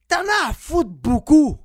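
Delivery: slow, loud shouted punchline. Acted. Punchline about not caring. (cri lent et fort)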